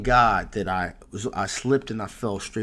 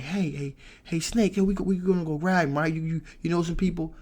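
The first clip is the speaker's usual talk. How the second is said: higher, hurried rallying of a buddy; hyped-up urging; 7 semitones higher than usual. Retold or imitated. Robbed clerk urging Snake to go after the robber, higher hurried urging. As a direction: retell yourself rushing to recruit a friend.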